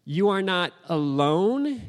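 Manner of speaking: slow, deliberate sermon delivery; emphatic preaching. Real, not acted. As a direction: let each phrase land slowly as an assurance.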